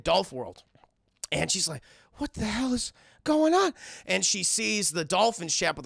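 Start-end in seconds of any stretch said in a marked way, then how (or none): 2.1-3.7 high-pitched, baffled question rising at the end; mock bewilderment. Girl finding herself back at Dolph World, mock bewilderment. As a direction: squeak it in exaggerated confusion.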